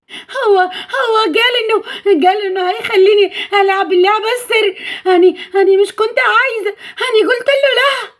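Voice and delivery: put-on high, halting childlike crying voice; acted upset girl confessing; tearful, distraught. A narrator's reading. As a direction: stammer it out through tears. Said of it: "سليم شاف نيلي بتعيط ومنهارة".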